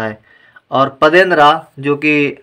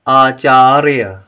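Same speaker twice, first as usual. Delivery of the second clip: Acharya stretched slowly and louder; slow model reading; 4 dB louder, much slower than usual. Shown as a demonstration. Teacher reads Acharya slowly for the class to repeat. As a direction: read Acharya slowly for pupils to copy. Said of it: "आइए पहले हम इन शब्दों को पढ़ें".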